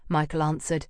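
ordinary delivery

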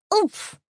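oomph. Acted. Bee lands clumsily on flower.

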